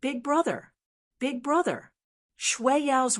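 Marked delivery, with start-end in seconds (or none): 0.0-1.9 pleading call. Acted. Frightened girl calling her brother.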